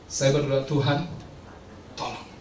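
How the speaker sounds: low, hushed retold prayer; subdued praying voice; quiet prayer. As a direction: murmur a plea to God, voice dropping away. Retelling his prayer for help.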